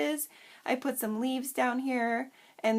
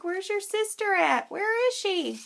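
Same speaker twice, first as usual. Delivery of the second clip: high, louder pretend voice; playful deer character; 9 semitones higher, 4 dB louder than usual. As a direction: voice a toy deer calling after its sister. Voicing a little deer family scene.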